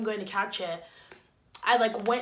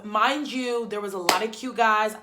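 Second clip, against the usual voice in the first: ordinary delivery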